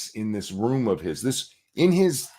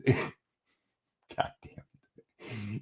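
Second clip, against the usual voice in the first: ordinary delivery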